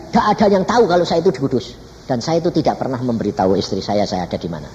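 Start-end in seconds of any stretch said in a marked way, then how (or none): none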